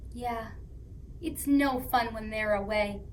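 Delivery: ordinary delivery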